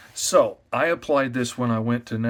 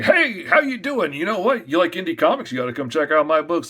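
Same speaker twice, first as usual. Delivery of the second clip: loud, much higher hawking call to passersby; showing his own booth style, a loud carnival barker pitch; 5 semitones higher, 6 dB louder than usual. Shown as a demonstration. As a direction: bark at the crowd like a fairground tout. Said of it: "I'm very carnival barker".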